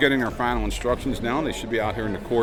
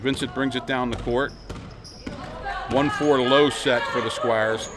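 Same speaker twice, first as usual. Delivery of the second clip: fast sports commentary; 3 semitones higher than usual. Acted. Live play-by-play of a game.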